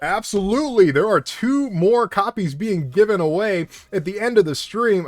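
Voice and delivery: booming hype announcer; big arena announcer read. Acted. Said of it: "I sound like a WWE announcer".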